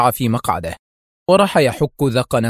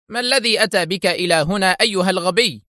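pitched up, exasperated cry; angry outburst; 8 semitones higher than usual. A narrator's reading. Eli finds Tawfiq at his door, calls out angrily. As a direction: snap at him in anger. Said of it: "فهتف به في حنق".

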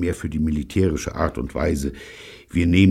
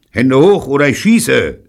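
loud, high warning; raised-voice ultimatum; shouted threat; 6 semitones higher, 9 dB louder than usual. Acted. Repeated threat, ignored by the children.

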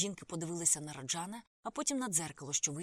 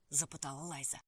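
ordinary delivery

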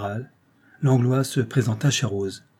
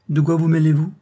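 higher, louder, haughty rebuff; 6 semitones higher, 6 dB louder than usual. A narrator's reading. Actress rebuffing the one who warns, haughty. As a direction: put them down loftily. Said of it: "répondit-elle avec hauteur".

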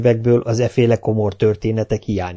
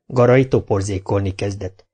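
ordinary delivery

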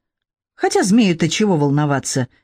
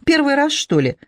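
dismissive snort; 8 semitones higher than usual. A narrator's reading. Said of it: "Змей фыркнул".

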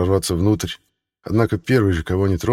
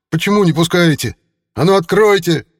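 much higher, louder furious yelling; angry crowd roar; 11 semitones higher, 6 dB louder than usual. Acted. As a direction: shout it at a locked door. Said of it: "взревели сразу несколько голосов".

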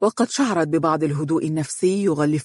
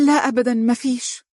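high, hesitant denial; flustered stammer; 7 semitones higher than usual. A narrator's reading. Frightened wife denying anything is wrong, flustered. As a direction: falter on the first word, pitched up. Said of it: "تلعثمت وهي تقول".

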